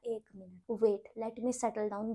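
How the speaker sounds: soft, very slow, shaken; stunned hush. Retold or imitated. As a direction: barely get the words out, stunned. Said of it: "दो period लगे मुझे ये बात settle करने में".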